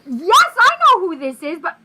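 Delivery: loud, high, incredulous mother; freaking out. Retold or imitated. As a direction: blurt it out in a re-enacted freak-out. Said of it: "I'm freaking out right at the moment".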